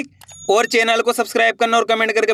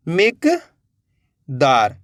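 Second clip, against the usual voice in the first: slow drawn-out word; 5 semitones lower, much slower than usual. Shown as a demonstration. Teacher to pupils revealing the finished word, very slow. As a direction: stretch मिकदार out very slowly, each sound held. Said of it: "तो मिलके बन गया अपने".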